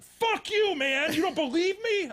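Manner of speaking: very high, loud furious yell; mock angry outburst. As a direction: snap at him in mock rage. Quoting friend's angry outburst.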